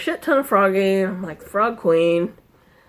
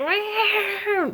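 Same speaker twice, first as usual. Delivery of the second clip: high mock cat meow; 11 semitones higher than usual. Imitating her overstimulated cat, a high meow. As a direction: mew like a startled cat. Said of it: "she's like".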